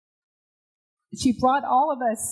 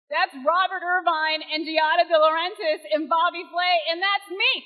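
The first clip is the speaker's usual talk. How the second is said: rising higher and louder to a cheer; soaring, louder inspirational peak; building triumph; 11 semitones higher, 3 dB louder than usual. Real, not acted. Triumphant climax of keynote.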